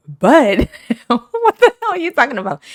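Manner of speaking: high, loud, fast baffled question; incredulous. Retold or imitated. To the customer about the best bud, high incredulous question. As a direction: ask in loud high disbelief.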